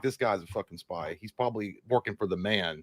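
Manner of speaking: low, hushed imitation of suspicious onlookers; suspicious muttering. Concertgoers sizing up the collared-shirt outsider, suspicious muttering. Voices suspicious crowd about him.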